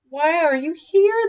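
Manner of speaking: high, curious little creatures; small animal voices. Acted. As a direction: ask like tiny forest animals. Animals ask Santa, small creatures.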